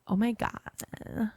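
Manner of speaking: slow, drawn-out creaky fry; vocal fry. Shown as a demonstration. Podcaster showing what vocal fry is, slow creaky drawl. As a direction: drag the words out into vocal fry. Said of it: "I have vocal fry".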